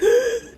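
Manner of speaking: sharp, high gasp; strained gasp for air. Shown as a demonstration. Gasping through a swollen throat. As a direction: gasp like you can't breathe. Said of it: "couldn't hardly breathe".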